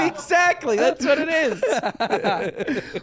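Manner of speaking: words squeezed out through real laughter; real laugh breaking through the words. Real, not acted. Laughing at the joke.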